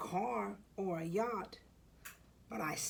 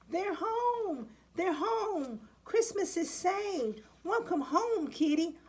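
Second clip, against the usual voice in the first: much higher, louder, overjoyed exclamation; joyous cry; 9 semitones higher, 4 dB louder than usual. Acted. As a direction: burst out with delight at their return. Pets return, joyous cry.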